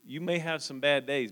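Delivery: ordinary talk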